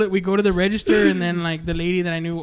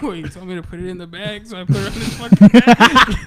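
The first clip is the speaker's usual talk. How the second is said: mock-serious, louder at the end; deadpan retelling; 8 dB louder than usual. Friend retelling the man's excuse with a mock-serious voice. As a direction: deliver it dead serious, like it was obvious. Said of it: "serious as fuck when he says it".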